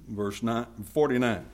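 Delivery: usual speaking voice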